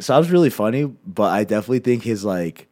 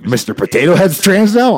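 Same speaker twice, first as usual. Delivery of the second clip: raised, high-pitched mock indignation; louder, incredulous grumpy-dad rant; mock angry dad; 9 semitones higher, 6 dB louder than usual. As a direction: imitate a dad outraged at the news. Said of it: "My dad just gets mad about shit now".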